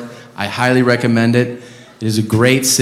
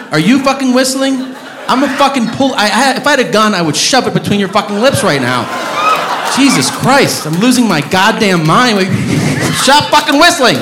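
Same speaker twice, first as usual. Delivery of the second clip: angry yell; 9 semitones higher than usual. Real, not acted. Yelling at a whistling audience member.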